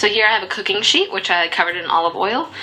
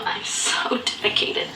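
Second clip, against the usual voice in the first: sarcastic whisper; 6 dB quieter than usual. Real, not acted. Sarcastic aside after canned beets.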